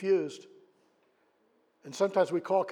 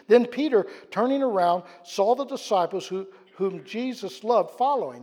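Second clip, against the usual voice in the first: preached louder and higher; rising preaching; 4 semitones higher, 7 dB louder than usual. Acted. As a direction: lift the story to the whole church. Preaching, retelling the Gospel.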